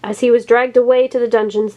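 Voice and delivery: usual speaking voice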